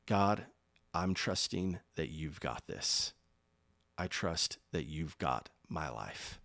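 slow, low, soft surrender; quiet prayer. Shown as a demonstration. Preacher models trusting God with his life, soft slow prayer. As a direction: pray it softly and slowly, letting go.

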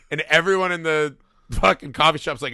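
normal talking voice

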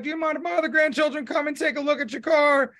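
high, fast polite granny voice; imitating granny. Granny asking to see the car, high quick voice. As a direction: lightly put on a sweet grandma asking.

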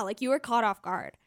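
ordinary delivery

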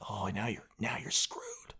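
whispered warning. Real, not acted. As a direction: whisper the doom. Ominous aside.

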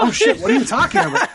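squeaky high laughing shock; panicked, laughing, startled squeal; shocked squeak. Retold or imitated. Actor caught out by the director, squealing in laughing shock. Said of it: "And I was like".

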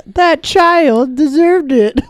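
high, slow sneering voice; mock mom. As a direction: parody her self-justification. Mocking the accused mom.

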